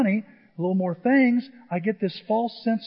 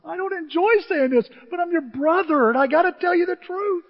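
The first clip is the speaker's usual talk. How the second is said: high, pressing plea; retold insistence; 9 semitones higher than usual. Preacher telling his crying brother the truth, insistent. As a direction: press the hard truth on him.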